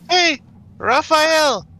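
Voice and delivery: high, slow questioning voice; every line ending in a rising question; uptalk. Acted. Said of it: "Like, very questioning that".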